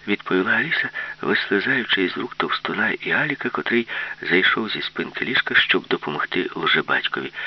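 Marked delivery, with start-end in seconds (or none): none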